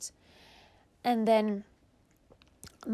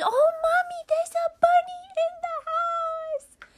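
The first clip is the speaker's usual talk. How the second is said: put-on excited little girl; 6 dB louder than usual. Big sister calling to Mommy about the bunny, high amazed squeal.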